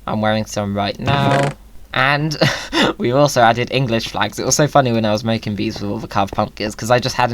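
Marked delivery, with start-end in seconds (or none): 2.4-2.9 high giggle. Real, not acted.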